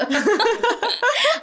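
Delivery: high giggling burst; real laugh. Real, not acted. Teller still laughing at her joke story. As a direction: keep giggling.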